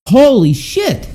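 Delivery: high, slow "Holy shit." in disbelief; stunned, drawn-out outburst; shocked exclamation. Real, not acted. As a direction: let the shock lift your voice way up. Stunned by child tape claim.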